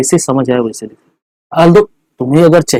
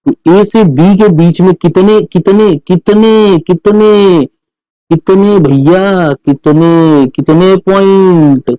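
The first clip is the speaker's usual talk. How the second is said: "कितने" repeated, each one stretched out long; drawn-out repeated question; 2 semitones higher, much slower than usual. Shown as a demonstration. Teacher hypes a question. ("कितने" को खींच-खींचकर दोहराते हुए)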